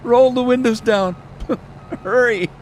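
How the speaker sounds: high, loud, laughing urgency; hammed-up order through laughter; urgent mock shout. A passenger urging the driver to open windows, loud and high. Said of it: "And the guy says".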